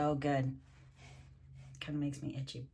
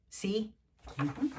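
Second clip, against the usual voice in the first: ordinary delivery, nothing marked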